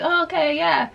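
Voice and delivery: high, airy okay, nice; distracted polite reply. Narrator answers the boy's small talk in a high, distracted okay. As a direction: humor him without listening.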